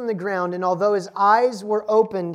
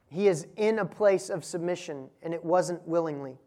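normal talking voice

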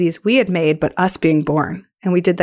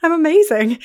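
retold delight; 8 semitones higher than usual. Acting her delighted response to praise.

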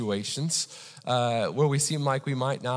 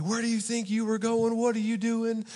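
higher-pitched mock scolding; imitated angry cop; 7 semitones higher than usual. Officer cussing at a lost driver, mock angry. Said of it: "He's cussing at me".

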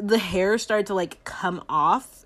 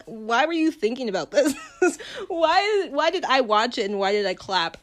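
high, louder, laughing through the questions; laughing disbelief; 6 semitones higher, 5 dB louder than usual. Real, not acted. Vlogger laughing at herself for clapping, loud and high. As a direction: laugh at your own choices. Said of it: "laughing disbelief".

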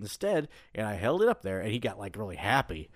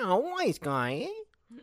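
slow mobster drawl, rising eh; wise guy voice; 11 semitones higher, much slower than usual. Retold or imitated. Voicing the cat as a wise guy, slow mobster style. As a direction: play it like a movie gangster. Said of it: "why does he talk like that".

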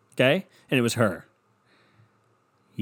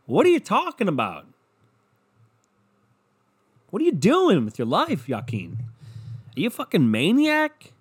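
high, swooping exasperation; mock incredulous berating; 5 semitones higher than usual. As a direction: demand an answer in hammed-up disbelief.

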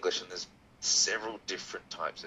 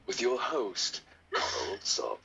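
dramatic announcer; much slower than usual. Shown as a demonstration. Host re-enacting the podcast intro, dramatically. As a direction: present the host with pomp. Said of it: "let me, let me be dramatic".

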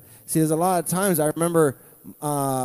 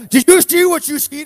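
mock angry text; 10 semitones higher, 8 dB louder than usual.

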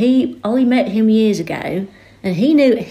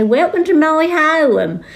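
slow, higher West Suffolk drawl, home as haʊəm; West Suffolk accent; 8 semitones higher, slower than usual. Shown as a demonstration. Mimicking the West Suffolk way of saying home. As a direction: stretch it out, West Suffolk style. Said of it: "But you'd say".

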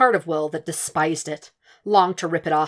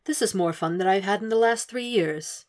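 a hint of gleeful menace; lightly voiced amused Hannibal; 3 semitones higher than usual. A narrator's reading. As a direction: savor it like a private joke. Hannibal amused while restrained.

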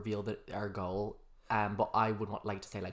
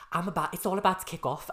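imitating a Scouse voice, higher and louder; Liverpool accent; 7 semitones higher, 7 dB louder than usual. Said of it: "You just did a Liverpool accent".

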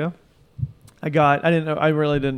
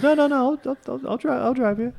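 insistent cab driver; 11 semitones higher than usual. Retold or imitated. Driver voiced in story.

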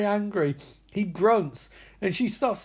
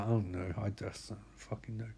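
very low, fading murmur; acted hopeless mumble; defeated father; 11 semitones lower, 14 dB quieter than usual. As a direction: trail away in resignation. Voicing bewildered, defeated father.

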